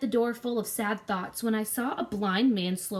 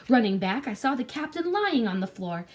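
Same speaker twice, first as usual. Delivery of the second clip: usual speaking voice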